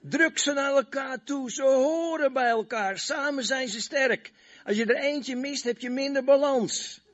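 high, strained pleading voice; voice of the body part. Acted. Preacher voicing the toe calling to God, high and pleading. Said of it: "En zegt".

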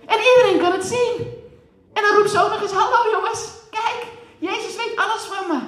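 loud, high, building sermon; rising preaching. Acted. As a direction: build it up with fervour. Preaching climax.

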